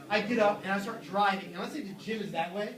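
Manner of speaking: ordinary delivery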